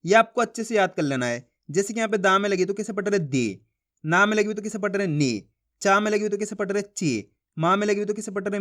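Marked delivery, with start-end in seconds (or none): 3.2-3.6 दी said short and low, the vowel clipped; short i, not drawn out. Shown as a demonstration. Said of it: "ज़्यादा खींच के नहीं पढ़ना". 5.1-5.5 नी said short and low, the vowel clipped; short i, not drawn out. Shown as a demonstration. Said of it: "ज़्यादा खींच के नहीं पढ़ना". 6.8-7.3 ची said short and low, the vowel clipped; short i, not drawn out. Shown as a demonstration. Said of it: "ज़्यादा खींच के नहीं पढ़ना".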